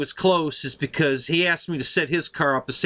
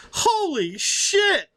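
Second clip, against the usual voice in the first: drawn-out awed exclamation, much higher and slow; stunned; much slower than usual. Retold or imitated. Driver stunned as the car takes the corner, awed slow exclamation. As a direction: blurt it out amazed, half laughing. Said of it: "And he goes".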